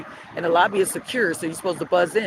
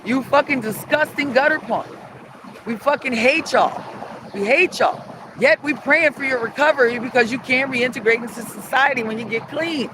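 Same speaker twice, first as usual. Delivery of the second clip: loud, higher-pitched tirade; angry rant; 6 semitones higher, 4 dB louder than usual. Real, not acted. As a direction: lay into him with emphatic insults. Ranting insults at the absent man.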